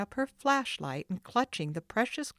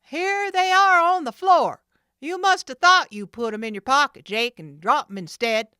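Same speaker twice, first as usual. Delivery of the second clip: much higher, loud gloating voice; third thug; 8 semitones higher, 13 dB louder than usual. Acted. Third man finds the keys, gloating. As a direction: announce it mockingly. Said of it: "the third man announced".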